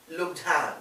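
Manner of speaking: ordinary talk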